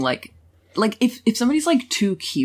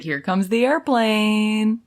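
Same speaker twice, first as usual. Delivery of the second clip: slow, drawn-out sing-song coaxing; baby-feeding voice; 2 semitones higher, slower than usual. Retold or imitated. Host imitates him baby-talking his lover, slow mock coaxing. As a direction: stretch it out as if spoon-feeding. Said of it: "He's like".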